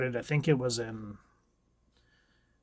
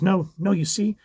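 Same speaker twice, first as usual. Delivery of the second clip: higher, louder desperate mother; Wanda pleading; 6 semitones higher, 6 dB louder than usual. Acted. Wanda clinging to her children in a higher, frantic plea. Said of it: "She goes".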